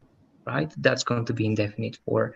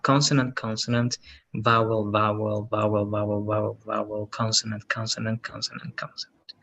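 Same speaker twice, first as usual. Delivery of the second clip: even monotone recital, fading away; monotone list trailing into near silence; reeling off a list; 2 semitones lower than usual. Shown as a demonstration. Teacher rattles off letter types.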